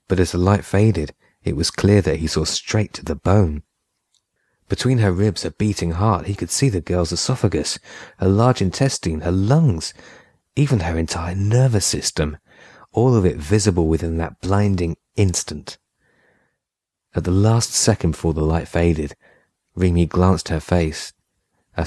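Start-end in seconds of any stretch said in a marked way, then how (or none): none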